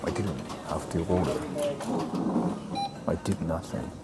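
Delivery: hushed whisper in the restaurant. Real, not acted. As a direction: whisper so nobody hears.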